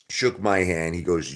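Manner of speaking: ordinary delivery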